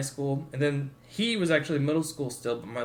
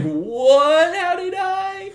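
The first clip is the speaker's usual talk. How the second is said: much higher, louder stunned question; shocked disbelief; 10 dB louder, much slower than usual. Retold or imitated. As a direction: react like the news just hit you. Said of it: "And I'm like".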